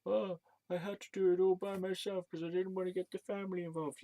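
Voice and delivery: high, whiny imitation of dad; sending up his father's self-pity; mocking dad. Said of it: "He was like".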